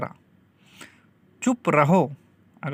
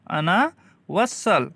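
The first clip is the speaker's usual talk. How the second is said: slow repeat; much slower than usual. Shown as a demonstration. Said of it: "slow teaching repeat".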